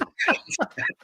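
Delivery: squeaky breathless giggle; embarrassed high laugh; high airy laugh. Real, not acted.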